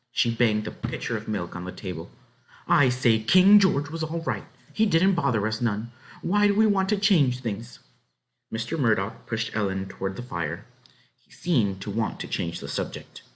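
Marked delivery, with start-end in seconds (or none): 2.7-7.8 heated wife; stubborn argument. Acted. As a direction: defend the king stubbornly. Wife argues.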